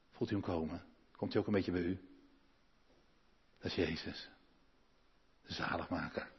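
soft tender invitation to feel Jesus near; hushed appeal. Real, not acted. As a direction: draw them in with a near hush. Intimate appeal to congregation.

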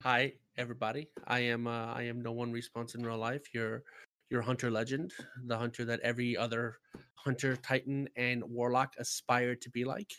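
low, hushed, grandiose legend voice; epic fantasy narrator. Pretend. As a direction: speak as a mythic hero boasting. Grandiose self-introduction as hunter legend.

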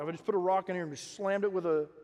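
usual speaking voice

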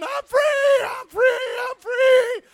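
very high, loud joyous cry; ecstatic shouting. Retold or imitated. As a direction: scream freedom at the top of the voice. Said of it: "he's going".

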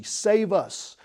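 a preacher voicing an urgent prayer; urgent plea. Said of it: "It is a prayer of urgency".

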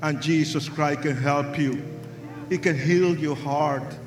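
low, soft invitation to pray; soft altar call. Real, not acted. Preacher softly inviting the crowd to pray, low and soft. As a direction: coax them gently toward prayer.

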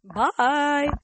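bye with a voice crack. Real, not acted. Host signing off, voice cracking. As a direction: let the voice break on the goodbye. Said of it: "The voice crack".

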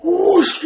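high loud swooshing noise; whoosh sound. Retold or imitated. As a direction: make a big whoosh of a swung club. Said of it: "just goes".

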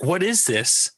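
mock streamer voice. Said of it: "the streamer's like".

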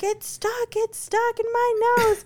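put-on very high panicked toddler voice; imitated child's scream. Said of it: "Axel comes screaming".

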